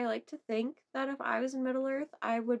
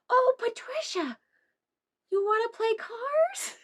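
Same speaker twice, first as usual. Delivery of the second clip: put-on toddler voice; 11 semitones higher, 5 dB louder than usual. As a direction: mimic a little girl asking to play. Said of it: "and she says".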